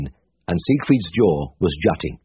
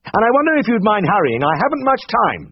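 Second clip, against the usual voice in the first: higher brisk voice for Siegfried; Siegfried's voice, higher and brisk; 13 semitones higher than usual. A narrator's reading. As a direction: voice the brisk senior vet. Siegfried's voice held in reading.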